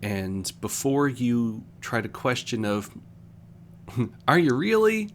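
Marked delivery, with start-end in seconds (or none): 4.3-5.1 high, loud incredulous challenge; mock the doubter who questions them; a jab of disbelief, pitched way up; sceptical doubter. Someone doubting a coming out.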